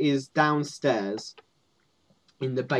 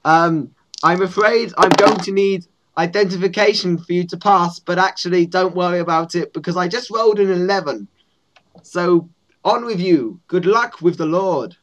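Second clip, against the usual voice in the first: higher, measured official guard voice; goblin guard; 5 semitones higher, 5 dB louder than usual. Acted. Goblin guard lets visitors pass, high and officious. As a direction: wave them through in a raised, formal character voice.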